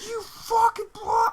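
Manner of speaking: screamed high and loud, slow and furious; road rage scream. Retold or imitated. Driver screaming at the woman who braked on a green arrow, furious yell. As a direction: explode at the driver ahead. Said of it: "I'm like".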